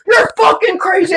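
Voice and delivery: high-pitched panicked shout; hammed-up frightened cry; alarmed yell. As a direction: imitate a man leaping out of the way. Man acted out dodging the bat.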